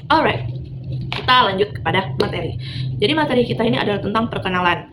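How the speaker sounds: ordinary delivery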